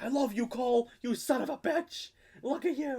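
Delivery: mock proud dad. Dad gushing pride at Cole in the sequel, high and soft. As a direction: gush over him like a choked-up dad. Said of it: "he's like".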